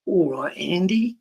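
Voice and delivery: a quiet hello to Andy; Brian James impression. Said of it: "do a good Brian James one as well".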